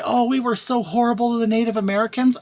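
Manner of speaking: lightly voiced guilty liberal, a bit high; sing-song mock apology. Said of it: "They'll all say".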